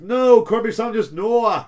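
high, loud Geordie wail; mock-anguished Geordie outcry; Geordie accent. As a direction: cry out in a badly aimed Scots accent that lands in Geordie. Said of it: "that's more Geordie".

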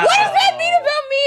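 high, loud comic wail; a jokey cry of mock anguish. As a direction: wail it in hammed-up despair. Joking mock distress about Kevin.

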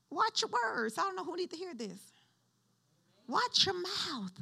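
high, softened voice after a shout; high, hushed admonition; warning. Real, not acted. Preacher warns congregation after loud command.

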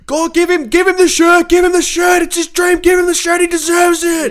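high, loud crowd chant; crowd shouting. Retold or imitated. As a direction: shout for the merch guy to hand it over. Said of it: "pointing at me, going".

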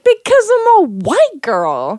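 high, loud, drawn-out comic retort; mock exasperated excuse. Joking about why they never called police, mock-exasperated.

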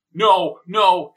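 loud, high panicked "No, no"; shouted denial. Acted. Young man caught over body, shouting panicked denial. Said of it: "shouted the young man".

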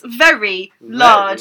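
"very large" stretched slowly with weight; slow emphatic "very large"; italics emphasis. Shown as a demonstration. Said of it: "um, italics".

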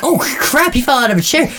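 high alarmed thought. Retold or imitated. Thinking the homeless man fell from his wheelchair, alarmed. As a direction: gasp it out in alarm. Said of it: "and we think".

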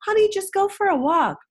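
high, patronizing singsong; mock breezy advice. Pediatrician's office brushing off a desperate mother, mock breezy. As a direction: imitate a dismissive, sugary office reply. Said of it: "I love their answer".

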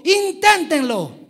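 Inténtenlo shouted high and slow, drawn out; a loud, slow Inténtenlo; exclaimed. Shown as a demonstration. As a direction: belt out Inténtenlo like an exclamation mark. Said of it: "Digan conmigo".

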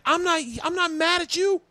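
very high-pitched mock yell; imitated yelling. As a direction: mimic her yelling, pitch shooting way up. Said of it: "she was yelling at me".

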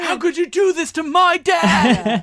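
high, drawn-out mock shout; super angry. Playing Tony as his dad is taken away, mock-furious shout. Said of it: "So he's super angry".

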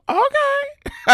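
very high, tiny okay; squeaky okay. Retold or imitated. Blanca answering the landlord with a very high squeaky okay. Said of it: "Blanca was like".

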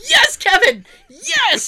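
high, loud cheer of approval; elated cheering. Retold or imitated. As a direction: cheer them on at a high, excited pitch. Cheering on the cabbie in story.

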